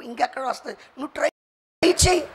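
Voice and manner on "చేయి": ordinary talk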